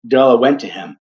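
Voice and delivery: plain reading voice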